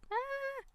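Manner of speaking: sudden high yelp at a bee; yelp, caught off guard; startled yelp. Real, not acted. Spots a bee in game.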